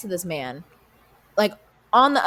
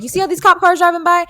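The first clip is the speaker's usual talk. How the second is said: higher, louder sudden outburst; exasperated burst; 10 semitones higher, 7 dB louder than usual. Retold or imitated. Telling the regular about the shooting, high exasperated burst. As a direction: blurt it in disbelief.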